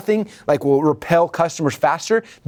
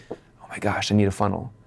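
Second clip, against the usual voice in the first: soft, lowered voice of realization; hushed epiphany; 5 semitones lower, 6 dB quieter than usual. Acted. Imagined customer realizing he needs a funnel, hushed. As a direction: let it dawn on you, quietly. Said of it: "he's gonna have the epiphany".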